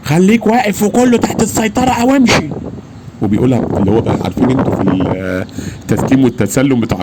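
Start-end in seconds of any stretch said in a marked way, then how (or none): 0.0-2.5 higher, stiff military-report tone; officer's official voice. Retold or imitated. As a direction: imitate a by-the-book officer ordering. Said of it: "اللي هو الصوت اللي هو الرسمي خالص".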